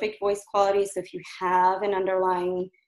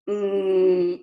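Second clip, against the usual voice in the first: louder, pushed throat hum; strained hum; 5 dB louder than usual. Shown as a demonstration. Coach showing the wrong hum to avoid, strained and louder. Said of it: "I'm feeling a lot in my throat there".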